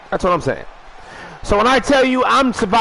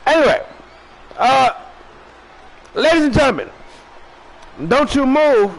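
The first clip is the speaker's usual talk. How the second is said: big announcer call; 6 semitones higher than usual. Acted. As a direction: boom it out like a wrestling announcer. Repeats sign-off, bigger.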